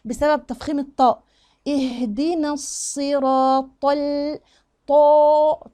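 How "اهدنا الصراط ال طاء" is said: slow chanted syllables, dark full "ط"; heavy taa. Shown as a demonstration. Teacher shows the thickening to learners, slow and dark. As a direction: stretch "الصراط" out with a thick, heavy ending. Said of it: "تفخيم أهو".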